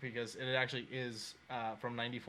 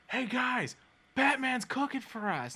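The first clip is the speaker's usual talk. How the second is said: high, loud announcement to a room; high-pitched retold yell; yelling to everyone; 5 dB louder, much slower than usual. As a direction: imitate a man calling out excitedly to the crowd. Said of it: "yells out to everyone".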